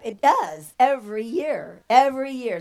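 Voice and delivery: loud, high, building sermon delivery; rousing preaching. Acted. Preacher urging the burned stones to give it to Jesus, fiery. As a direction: drive it home to the congregation.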